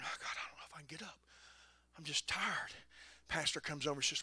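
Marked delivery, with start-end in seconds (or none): 0.0-2.7 whispered, worn-out groan to himself; a tired inner whisper; weary whisper. Retold or imitated. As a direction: mutter it like you can barely get up. Tired preacher voicing his own thoughts.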